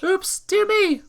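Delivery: high, loud flustered cry; startled yelp. Acted. Woman whose cart hits a hole, high startled cry.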